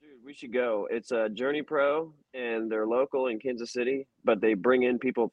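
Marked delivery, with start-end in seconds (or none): none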